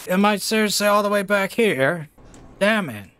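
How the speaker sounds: high-pitched, indignant disbelief; exasperated protest. Real, not acted. Player sent all the way back, exasperated. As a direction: complain high at the unfair setback.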